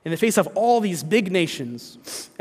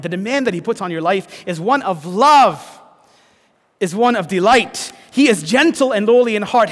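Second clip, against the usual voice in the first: louder, rising preacher's cry; preaching at full force; 4 semitones higher, 6 dB louder than usual. Acted. Pastor urging the congregation toward Jesus, in full passionate preaching. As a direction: lift the room with the good news.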